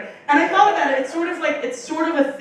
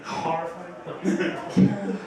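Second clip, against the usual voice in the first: deadpan; 5 semitones lower, 6 dB quieter, much slower than usual. Real, not acted. Deadpan after the story.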